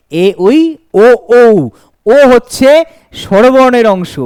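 teacher chanting the Bengali vowel series; reciting vowels. Shown as a demonstration. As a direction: recite the vowel list, stressing ও. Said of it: "অ, আ".

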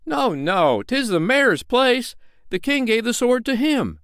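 high, loud protest; crowd objecting. Acted. Other townsfolk insist on the mayor, loud. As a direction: argue back. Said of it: "said others".

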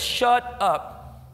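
lightly voiced God silencing him, blunt; a touch of the Lord shutting down his doubts; the Lord's voice. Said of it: "he says".

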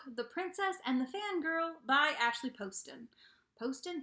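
ordinary talk, nothing marked

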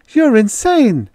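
high, loud, slow shriek. A narrator's reading. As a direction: shriek it in horror. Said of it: "shrieked the woman".

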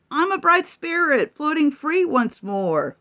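light and floating, a little thrilled; mild excitement. Acted. Donkey spirit freed from its suit, mildly excited. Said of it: "Donkey said".

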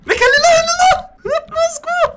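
high, panicked calls while fleeing; being chased. Real, not acted. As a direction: call out as if running for your life. Fleeing a mob in game.

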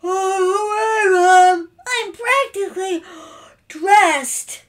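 big loud yawn into words; high, loud voice of a boy caught in bed; sleepy boy in bed. A narrator's reading. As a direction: call back through a yawn. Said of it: "still under the covers of his bed, said".

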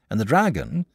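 ordinary delivery, nothing marked

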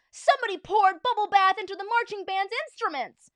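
loud, high holler; high-pitched outraged fan yell; fan's shout. A narrator's reading. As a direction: yell out from the bleachers. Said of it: "shouted a fan in the bleachers".

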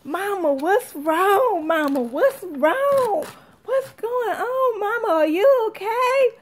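put-on high, drawn-out worried child questions; mock-alarmed little one calling for Mama; imitating scared child. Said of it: "she was scared".

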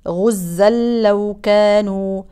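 غزا لو with the tanween merged into the lam; connected reading. Shown as a demonstration. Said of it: "يدغم التنوين في اللام". (إدغام التنوين في اللام)

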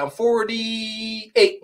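high, drawn-out mock quote; mimicking her answer. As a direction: imitate her, stretching the number out slowly. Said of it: "she said".

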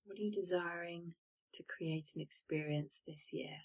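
unvoiced reflective whisper; hushed coaching whisper. Shown as a demonstration. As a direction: whisper the question inward. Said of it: "guided reflection prompt".

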